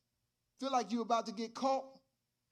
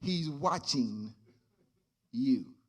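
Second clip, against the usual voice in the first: deep, slow voice from the dark; mysterious voice; 8 semitones lower, slower than usual. Acted. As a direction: speak low like an unseen watcher in the room. Deep voice echoes the warning.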